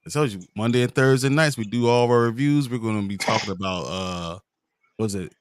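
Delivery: ordinary talk, nothing marked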